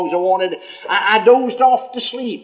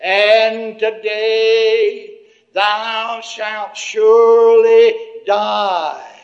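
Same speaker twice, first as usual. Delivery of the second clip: very slow, grave pronouncement; slow, louder on surely die; solemn heavenly voice; 2 semitones higher, 4 dB louder, much slower than usual. Retold or imitated. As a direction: pronounce doom slowly and gravely. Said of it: "And the voice said".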